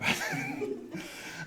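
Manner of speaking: short high breathless laugh. Real, not acted. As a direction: laugh at running out of air. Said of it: "I'm out of breath up here".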